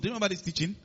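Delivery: ordinary talk, nothing marked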